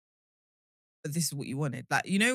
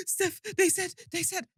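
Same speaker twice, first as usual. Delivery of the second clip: high, breathless shocked gasp; 12 semitones higher than usual. Retold or imitated. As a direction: gasp in outrage at the comments. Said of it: "I was like, gasps".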